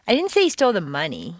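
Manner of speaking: quick run up to an emphasized "money"; stress on MONEY. Shown as a demonstration. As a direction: punch "money" to imply another item.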